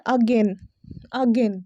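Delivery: again opening on a short "uh"; correct pronunciation. Shown as a demonstration. Teacher giving the right form, correct pronunciation. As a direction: say again as uh-GEN. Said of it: "correct pronunciation है".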